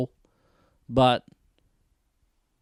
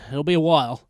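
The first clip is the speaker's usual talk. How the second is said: ordinary talk, nothing marked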